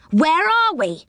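put-on high startled call from a boy; cried out. Rick waking up as he slides off the bed, crying out high. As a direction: cry out in alarm as you fall. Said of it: "cried Rick".

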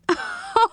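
high burst of laughter; laughing. Acted.

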